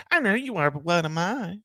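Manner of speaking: squeaky, high-pitched catchphrase voice; high, squeaky comic impression; Pee Wee Herman. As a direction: pitch it way up, childlike and sing-song. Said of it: "squeaky, high-pitched voice".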